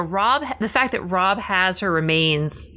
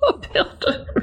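laughing; 4 semitones higher than usual. Real, not acted. Cracking up at the joke.

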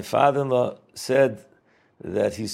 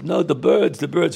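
put-on louder, insistent Litvish-accented correction; 4 semitones higher, 6 dB louder than usual. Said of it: "He said it in that Litvish accent".

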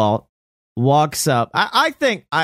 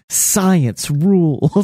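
drawn-out catchphrase; 5 semitones higher, much slower than usual. Pretend.

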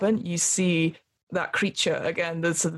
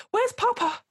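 put-on very high little-boy voice; child asking. GM voices the boy Arthur asking for his father. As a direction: ask for Papa like a small lost child. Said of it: "Arthur's like".

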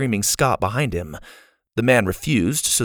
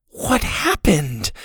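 slow, higher cry of dismay; 4 semitones higher, much slower than usual. A narrator's reading. Susie seeing the wrecked village below, crying out in dismay. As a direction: stretch the question out in shocked dismay. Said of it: "she cried".

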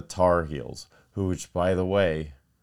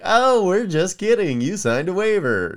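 breezy prankster; 12 semitones higher, 8 dB louder than usual. Retold or imitated. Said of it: "But it's like".

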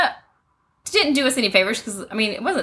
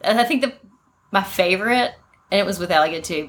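normal talking voice

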